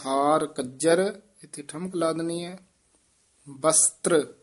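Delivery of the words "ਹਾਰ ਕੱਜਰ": slow, with a pause after ਹਾਰ and ਕੱਜਰ said tight and rising. Shown as a demonstration. Teacher demonstrates careful, tight ਕੱਜਰ for a student. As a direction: space the words and tighten ਕੱਜਰ. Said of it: "ਕੱਜਰ ਥੋੜ੍ਹਾ ਜਿਹਾ ਕੱਸ ਕੇ ਬੋਲਣਾ ਹੈ".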